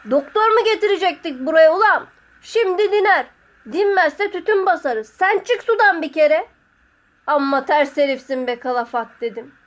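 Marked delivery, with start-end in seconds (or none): 0.1-6.5 loud, high-pitched rebuke; scolding shout. A narrator's reading. Master answers the whining apprentice, scolding shout. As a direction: scold him impatiently across the water.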